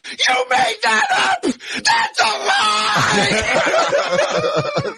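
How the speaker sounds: very high, slow, crying shriek; hammed-up high wail; mock shrieking Pete. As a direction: howl it like a wounded liar. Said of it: "he was going".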